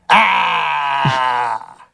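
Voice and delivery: hearty cheer; beer cheer shout. Retold or imitated. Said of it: "my slogan would be".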